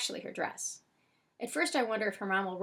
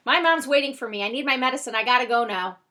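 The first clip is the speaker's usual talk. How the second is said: loud, raised insistence; urgent blurt; 4 semitones higher, 11 dB louder than usual. A narrator's reading. Boy remembers his mom is waiting, loud and urgent. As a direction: announce it with sudden urgency.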